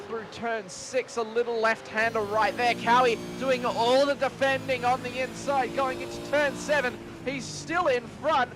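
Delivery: building excitement through the corner; motorsport commentator. Real, not acted. Live race play-by-play.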